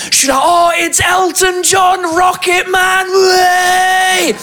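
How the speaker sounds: high, slow starstruck gushing; excited fan. Retold or imitated. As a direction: gush like a fan. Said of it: "Moi, je suis fan".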